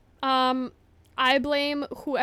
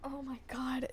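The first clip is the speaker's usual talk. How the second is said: whispered in disbelief; shocked whisper; 17 dB quieter than usual. Real, not acted. Streamer whispering after banning a friend by accident. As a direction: breathe it out, stunned.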